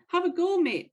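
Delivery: put-on playful stab at an Australian accent; attempted Aussie accent. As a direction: call it out in a half-convincing Australian accent. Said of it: "I can't do it in an Aussie accent".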